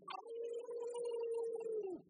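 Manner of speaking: loud, high-pitched dog howl; dog's howl. Retold or imitated. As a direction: throw the head back and howl like a dog. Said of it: "threw his head back and hollered".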